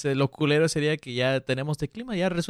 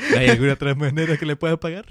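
laughing mock offer; 5 dB louder than usual. Joking suggestive quote.

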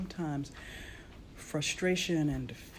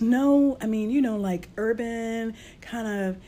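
mock client voice; 7 semitones higher, 8 dB louder than usual. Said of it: "she says".

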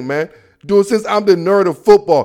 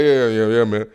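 much lower, soft grudging moan; grumpy old dad; 10 semitones lower, 5 dB quieter than usual. Retold or imitated. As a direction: grumble it like a father who hates birthday wishes. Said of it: "You're like".

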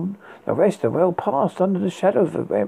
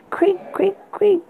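high breathy creak whispered; slower than usual. A narrator's reading. Creaking sound effect voiced.

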